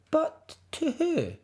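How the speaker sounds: high, curious little cub; lightly voiced cub. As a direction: wonder aloud like a mischievous cub. Cub wonders underwater.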